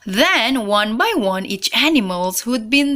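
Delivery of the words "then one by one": usual speaking voice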